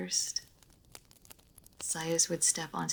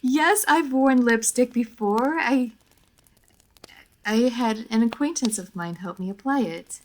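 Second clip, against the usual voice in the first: higher and louder, slowed, defensive; flustered defensive; 5 semitones higher, 9 dB louder than usual. Acted. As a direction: protest a bit too eagerly. Flustered defending lipstick experience.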